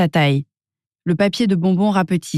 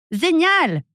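much higher joyful cry; gleeful shout; 11 semitones higher than usual. A narrator's reading. Mochu whooshes down the giant slide, high gleeful cry. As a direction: whoop down the slide. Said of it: "hurle Mochu".

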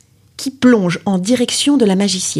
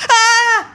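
startled cry. Acted. Girl startled by panther.

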